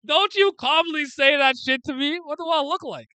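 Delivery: indignant burglar yelling back; outraged yell. Retold or imitated. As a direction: shout it in disbelief. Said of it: "We go, like".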